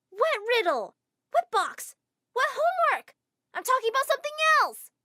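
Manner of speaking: high, loud and exasperated, climbing with each question; exasperated outburst. Acted. Girl snaps at the clueless teacher, loud and exasperated. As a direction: fire the questions back in disbelief.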